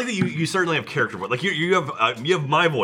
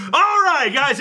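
explosive high, loud opener; Jeff; 14 semitones higher, 5 dB louder, much slower than usual. Retold or imitated. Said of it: "then Jeff's like".

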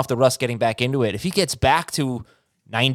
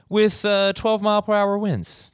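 announcer-style forecast read; weather forecaster voice; 9 semitones higher, slower than usual. Acted. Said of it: "Not the right voice".

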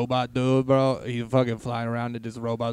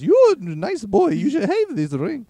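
put-on much higher, louder, slow old wizard; Gandalf voice; 12 semitones higher, 8 dB louder than usual. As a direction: croak it out as a kindly old wizard. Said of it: "I can't do that voice for that long".

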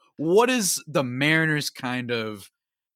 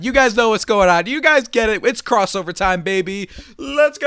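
loud hype man call; hyped up; 8 semitones higher, 6 dB louder than usual. Real, not acted. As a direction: pump up the crowd, louder. Hype call to start crossover.

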